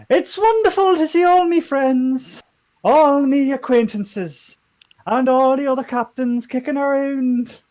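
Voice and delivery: high, loud, slow Geordie character voice; Geordie-like accent. Acted. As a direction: address the crowd in a wobbly put-on Geordie. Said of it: "She turned into a Geordie".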